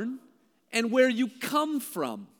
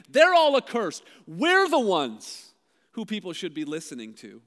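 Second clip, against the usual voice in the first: contemptuous outburst; 7 dB louder than usual. Retold or imitated. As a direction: spit out the contempt from above. Pharisees looking down on crowd.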